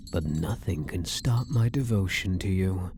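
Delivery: ordinary talk, nothing marked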